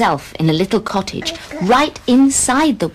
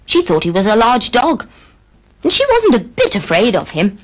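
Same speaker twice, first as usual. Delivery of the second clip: plain reading voice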